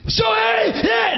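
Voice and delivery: high-pitched crazed shout; manic yelling. Retold or imitated. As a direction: yell like an over-the-top auditioner. Re-enacting his manic screamed audition.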